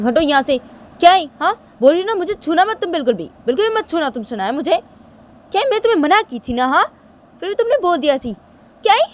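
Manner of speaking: loud, indignant outburst; angry Tisha. Acted. Tisha scolds Shanaya for touching her, angrily.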